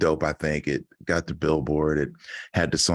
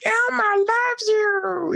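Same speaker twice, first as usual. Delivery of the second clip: Elmo voice; much slower than usual. Pretend. Playing Elmo for the little kid, very high and slow. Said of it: "I've been doing Elmo voices all week".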